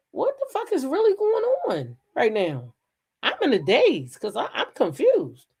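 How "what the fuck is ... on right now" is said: shocked disbelief. Retold or imitated. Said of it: "So I'm like".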